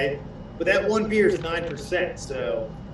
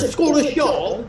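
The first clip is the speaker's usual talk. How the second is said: put-on higher, slow, botched Scottish accent; bad Scottish accent; 6 semitones higher, slower than usual. Co-host showing up the host's attempt, bad Scottish accent. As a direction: have a go at Scottish and miss. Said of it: "I can't do a Scottish accent".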